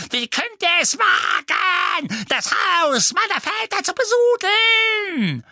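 very high, drawn-out screech of abuse; shrieking. A narrator's reading. Portrait of old woman cursing intruders, shrieking. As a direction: scream like a frenzied old hag. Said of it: "die Frau kreischte nur noch lauter".

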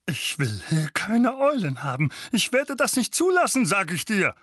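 high loud ranting; furious refusal. A narrator's reading. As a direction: put your foot down in rage. Vernon refuses owls.

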